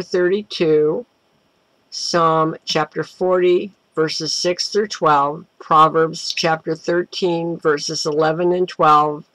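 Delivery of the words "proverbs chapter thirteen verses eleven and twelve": ordinary talk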